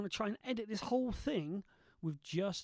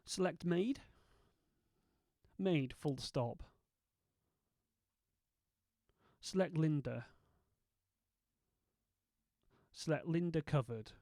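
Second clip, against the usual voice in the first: dictation commands. Shown as a demonstration. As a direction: dictate each word clearly to software. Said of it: "edit this with nothing more than my voice".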